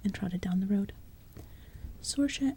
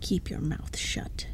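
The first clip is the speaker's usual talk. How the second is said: snarled order; 4 semitones lower, 4 dB quieter, slower than usual. Acted. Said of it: "The Nockmaar sergeant snarled".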